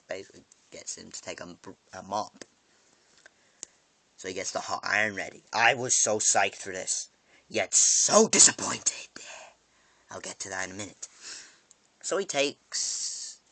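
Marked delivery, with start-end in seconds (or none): 5.5-9.1 psyched then deflated. Real, not acted. Excited then let down.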